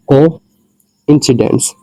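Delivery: Coincidence said slowly and carefully; slow demo pronunciation. Shown as a demonstration. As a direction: drag out coincidence for learners. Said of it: "example देख लेते हैं". (Coincidence धीमे, सधे उच्चारण में)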